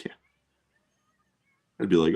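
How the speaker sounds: ordinary delivery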